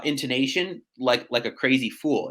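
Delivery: ordinary talk, nothing marked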